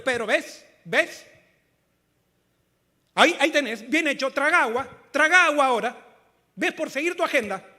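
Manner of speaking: loud, high, told-you-so rebuke; jeering "serves you right" at full volume; mock scolding. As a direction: scold a sinking man loudly, rubbing it in. Imagined scolding of drowning Pedro.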